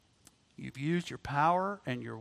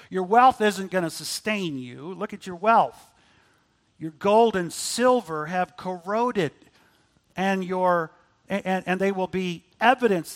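preaching at full force; 3 semitones higher, 7 dB louder than usual. Acted. Preacher warning the rich their wealth will fail, loud. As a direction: warn the rich with building force.

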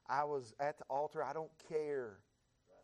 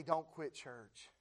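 ordinary delivery

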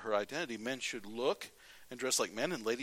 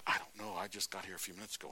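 soft, low, hurried imitation of a bystander; mock clueless mumble; 6 semitones lower, 7 dB quieter, faster than usual. Preacher voicing a waiting-room newcomer, quiet mock cluelessness. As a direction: play someone quietly at a loss.

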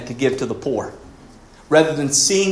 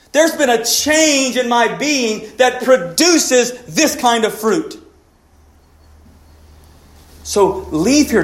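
raised, emphatic sermon delivery; preaching at full force; 8 semitones higher, 4 dB louder, slower than usual. Acted. Preacher urging the church to be loving, emphatic. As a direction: plead with them to change, slowly. Preaches being over doing.

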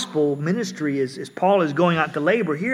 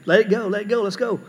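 usual speaking voice

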